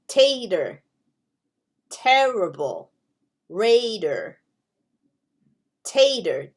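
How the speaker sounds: "tater", "terrible", "raider" langzaam en duidelijk, elk woord dalend; short E words. Shown as a demonstration. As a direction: lees de voorbeeldwoorden één voor één uit. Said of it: "It sounds like".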